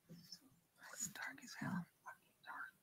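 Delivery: real hushed, nervous whisper; scared whisper. Real, not acted. Ghost hunter alone in pitch dark, whispering scared. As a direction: whisper it, barely any voice. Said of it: "I'm whispering 'cause I'm scared".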